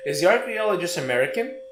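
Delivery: usual speaking voice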